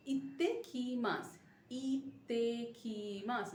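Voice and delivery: "ইত্তেকিমাস" slow, with a doubled t held; teacher drilling "ইত্তেকিমাস" slowly with the doubled t; slow teaching pronunciation. Shown as a demonstration. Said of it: "double pronunciation of".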